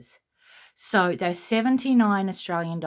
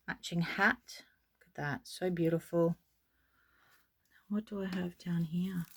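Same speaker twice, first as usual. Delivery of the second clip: breathy whisper to herself; 8 dB quieter than usual. Real, not acted. Whispering while peeking in the drawer. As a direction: whisper as if not to wake anyone.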